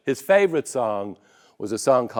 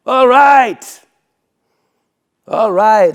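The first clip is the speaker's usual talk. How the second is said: loud, high exasperated all right; impatient robber; 9 semitones higher, 12 dB louder, slower than usual. Acted. Robber waiting for the miser, a loud impatient all right. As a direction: huff it like a fed-up gunman.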